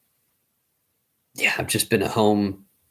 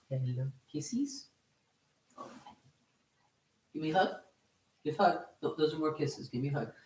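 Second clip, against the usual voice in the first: talking to a dog; 5 semitones higher, 9 dB quieter than usual. Real, not acted. Soft high baby talk to dog.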